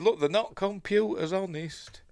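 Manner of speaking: a lightly voiced hacker insisting he's innocent; mock pleading. Hacker pleads innocence to FBI agents, mock pleading.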